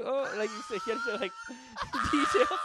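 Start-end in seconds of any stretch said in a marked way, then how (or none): none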